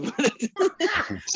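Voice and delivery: high burst of laughter; cracking up at his own joke. Real, not acted.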